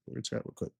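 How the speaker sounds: whispered under the breath, barely voiced; whispered aside. Real, not acted. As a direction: drop to a whisper and repeat it to yourself.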